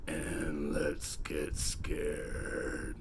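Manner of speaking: very slow, low creepy voice; spooky voice. Acted. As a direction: drag it out like a horror host. Spooky sign-off.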